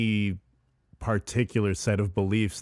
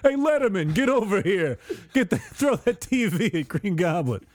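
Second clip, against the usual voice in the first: calling out; 9 semitones higher than usual. Retold or imitated. Calling out to Letterman.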